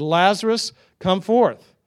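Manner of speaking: preacher acting out a commanding call; loud calling out. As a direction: call him out in a loud, high voice. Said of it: "he just spoke in a very loud voice".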